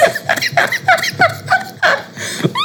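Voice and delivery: airless squeaky laugh; real silent high-pitched laugh; high breathless laugh. Real, not acted. Laughing at own story.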